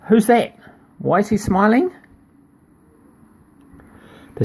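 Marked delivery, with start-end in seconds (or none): 0.1-1.9 mock alarm. Reader voices child's alarm.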